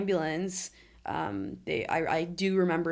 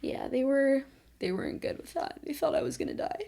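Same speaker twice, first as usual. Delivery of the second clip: getting emotional; 9 semitones higher than usual. Real, not acted. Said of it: "this is when I get emotional".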